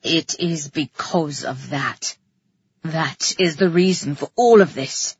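low, menacing male threat; snarling. A narrator's reading. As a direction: threaten her in a low-pitched growl. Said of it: "he snarled".

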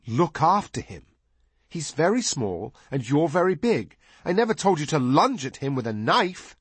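high, over-enunciated lecturing; exaggerated care. A narrator's reading. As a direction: explain it with pointed, exaggerated patience. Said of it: "Peter said with exaggerated care".

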